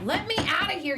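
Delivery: angry demand. Acted. Locked in the guest room, yelling at her captor. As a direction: shout it at the door.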